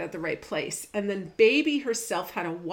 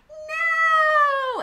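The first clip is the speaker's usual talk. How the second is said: imitating a child's shrieking No, much higher and louder; screamed no; 9 dB louder than usual. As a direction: shriek it like a furious toddler. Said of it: "she'd scream and yell and cry".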